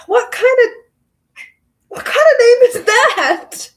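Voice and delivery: loud, high-pitched disbelief; incredulous outburst. Real, not acted. Reader thrown by a name in the book, loud incredulous outburst. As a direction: burst out, baffled, pitch shooting up.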